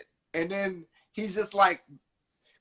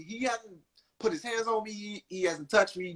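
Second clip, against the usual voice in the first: normal talking voice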